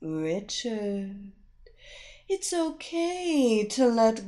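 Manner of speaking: slow seductive hypnotic whisper-like voice. Acted. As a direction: pull him under slowly. Hypnotist lulling him deeper.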